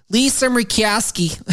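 high slow Midwestern drawl on Lisa Murkowski; Midwestern accent. Acted. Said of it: "I like it better when you do it that way".

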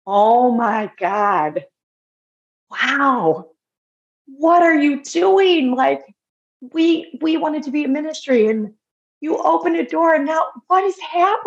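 overwhelmed with wonder at a miracle; awed reverence. Retold or imitated. Woman seeing a positive test, awed and reverent. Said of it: "but, like, in reverence".

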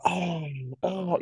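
high, startled oh at the smell; mock disgusted oh. Parents open the kids' door and recoil at the smell, high and startled. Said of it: "you're like".

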